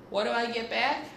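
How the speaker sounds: ordinary delivery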